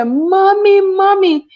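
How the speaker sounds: high, loud cry of Mommy, Mommy; a child screaming for her mother. Retold or imitated. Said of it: "if she scream out and say".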